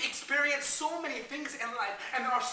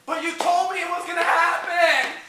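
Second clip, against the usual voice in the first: loud, higher, whiny protest; let-down whining; 5 semitones higher, 9 dB louder, slower than usual. Acted. Disappointed kid protesting to mom, loud and whiny. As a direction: complain like a cheated kid.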